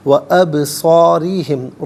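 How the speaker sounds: و ابصارہم with a light, thin ra; thin ra recitation. Shown as a demonstration. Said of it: "را کو باریک کر کے پڑھا جائے گا".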